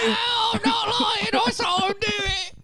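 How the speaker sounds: very high shouting accusation; high, frantic insistence; hysterical wife. Acted. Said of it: "This, this character is his wife".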